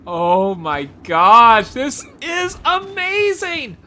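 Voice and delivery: loud, slow, awestruck cry; amazed exclaim. Real, not acted. Gamer seeing a hidden area to viewers, amazed loud exclaim. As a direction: let the wonder burst out, drawn long. Awed by a new game area.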